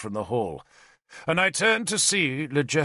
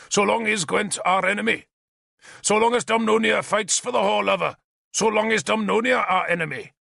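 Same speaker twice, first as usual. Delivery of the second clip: rabble-rousing shout; 9 semitones higher than usual. A narrator's reading. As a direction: whip the hall into a frenzy. King rousing his hall against Arthur.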